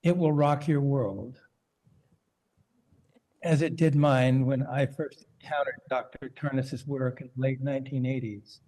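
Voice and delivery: ordinary delivery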